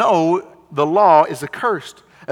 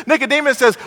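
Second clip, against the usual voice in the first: ordinary delivery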